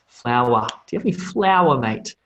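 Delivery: a fast, chirpy Australian ask with flour clipped short; Australian accent. Shown as a demonstration. Teacher showing his own Australian accent to learners, fast and casual. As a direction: toss off Do you have any flour, mate like an Aussie. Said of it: "They sort of cut the end off".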